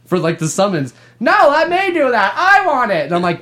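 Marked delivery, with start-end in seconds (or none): 1.2-3.1 high, whiny-insistent cartoon voice; hammed-up high mock yell; bad Woody voiceover. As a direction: demand it like a pestering cartoon cowboy. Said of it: "really bad Woody voiceover".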